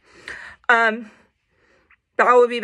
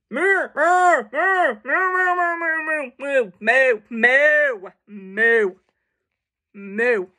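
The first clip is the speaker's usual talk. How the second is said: repeated mooing; much slower than usual. Acted. Pig telling the cow to moo, repeated descending moos. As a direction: keep mooing insistently, pitch gradually falling. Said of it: "That was the pig telling him to moo".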